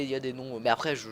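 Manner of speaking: usual speaking voice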